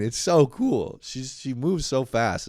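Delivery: usual speaking voice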